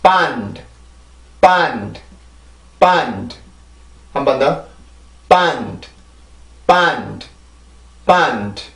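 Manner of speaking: 반드 slow and distinct, with a falling end; model 반드 drill. Shown as a demonstration. Teacher drills 반드 for students, slow and clear. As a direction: say 반드 with a pause after each take. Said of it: "발음을 다시 해봅시다".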